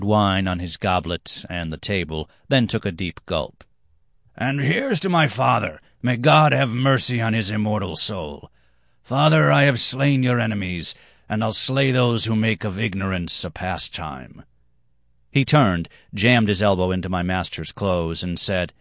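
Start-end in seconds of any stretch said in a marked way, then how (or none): 4.4-14.4 fervent toast. Acted. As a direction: raise the cup and declare. Drunken toast to his father.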